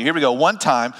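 normal talking voice

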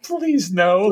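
high mock plea; pleading movie character. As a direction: imitate a helpless little plea. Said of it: "poor guy number three says".

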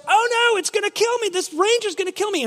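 high, panicky animal voice; mock terror, pitched high; scared bear. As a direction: squeal it out like a frightened bear. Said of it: "the bear goes".

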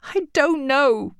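sobbing. A narrator's reading. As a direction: answer through tears. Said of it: "she sobbed".

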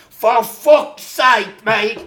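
hammed-up English accent, dragging it out. Injured pal on the bench groaning, in a mock English accent. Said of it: "'Cause Mark had a real English accent".

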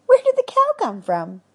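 high, startled cry of disbelief; shocked. Acted. Wife startled by a cow in the house, high shocked cry. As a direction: blurt it out in shock.

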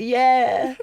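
high, loud imitation of a clueless friend; eager mock agreement. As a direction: agree too eagerly, hamming it up. Mimics friend agreeing without understanding.

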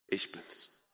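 hushed, low, barely voiced; quiet divine reply. God answering a self-accusing believer, quiet divine reply. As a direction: answer in a near whisper.

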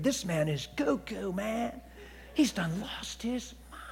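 slow, drawn-out mock disbelief; mimicking baffled onlookers. Onlookers judging the man talking to himself, slow hushed disbelief. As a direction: stretch it out softly, rising to a hush. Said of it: "They're thinking".

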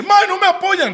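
mock whine of being unsupported; mock whiny artist. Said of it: "el mae llega y dice". (imitación burlona de un artista quejándose)

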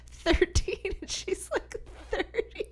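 high, soft squeak through laughter; helpless laughing. Real, not acted. Co-host describing the awkward age gap, squeaky laughter. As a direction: squeeze the words out while laughing.

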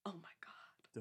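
breathless whispered awe; awed whisper. Retold or imitated. Viewer reacting to the song, whispered awe. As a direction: whisper it, stunned. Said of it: "you're like".

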